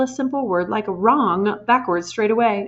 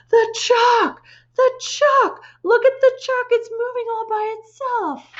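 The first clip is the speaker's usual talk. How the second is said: put-on much higher shrill panicked child; hysterical shrill scream; 4 dB louder than usual. A narrator's reading. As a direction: scream in terror at the chalk.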